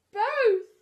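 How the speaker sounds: very high, loud eager Both; excited. Acted. Pooh offered honey, excited blurt. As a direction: blurt it out greedily. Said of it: "he was so excited that he said".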